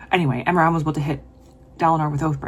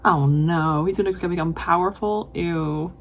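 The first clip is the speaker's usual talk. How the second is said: ordinary delivery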